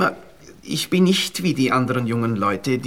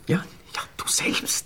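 leise, tonlos; flüstert zärtlich; geflüstert; 7 dB quieter, slower than usual. Acted. Zärtliche Antwort im Bett. (zärtliches Flüstern)

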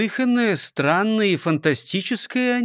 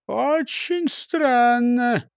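slow, higher-pitched musing; puzzled mutter; 6 semitones higher, much slower than usual. A narrator's reading. Mayor wonders at the firemen, lightly muttered puzzlement. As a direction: draw it out as if puzzled. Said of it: "пробормотал бургомистр Великолепсен".

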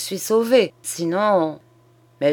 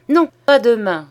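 louder, higher, impatient no; emphatic self-correction; 6 semitones higher, 9 dB louder than usual. A narrator's reading. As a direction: cut in firmly on your own offer. Old man corrects himself eagerly. (refus vif, plus fort et plus aigu)

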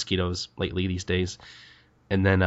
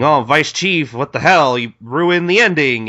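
loud, raised-pitch group protest; imitated troops' outcry; indignant exclaiming; 6 semitones higher, 5 dB louder than usual. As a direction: exclaim it high and loud in mock outrage. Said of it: "they're just exclaiming".

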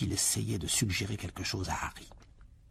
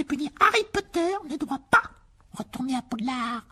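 house elf Dobby; 4 dB louder than usual. Acted. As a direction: squeak it out like an earnest little elf.

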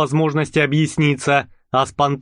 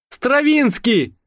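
calling out; 10 semitones higher, slower than usual. A narrator's reading. Gendarme calling after pair.

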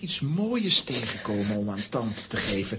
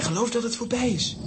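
ordinary talk, nothing marked

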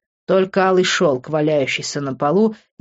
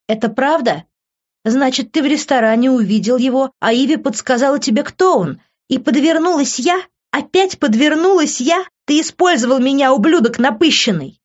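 higher and louder, rising to an outraged yell; furious accusation; 8 semitones higher, 3 dB louder than usual. A narrator's reading. Liv confronts Vincent, furious, louder and higher. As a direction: she realises she was used and lets him have it.

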